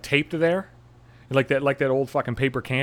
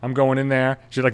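ordinary delivery

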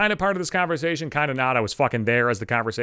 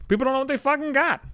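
higher ranting repeat; 12 semitones higher than usual. Real, not acted.

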